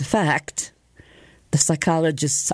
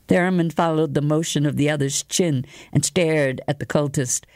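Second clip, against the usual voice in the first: plain reading voice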